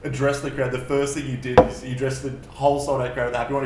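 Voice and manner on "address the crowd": normal talking voice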